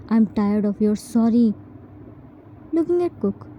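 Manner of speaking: usual speaking voice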